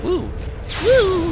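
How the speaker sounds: high loud woo; real celebratory whoop; excited whoop. Real, not acted. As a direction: cheer like you just won. Celebrating kill.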